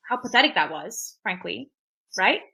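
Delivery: ordinary delivery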